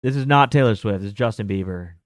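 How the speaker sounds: ordinary talk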